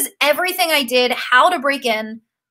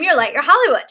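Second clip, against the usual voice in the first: normal talking voice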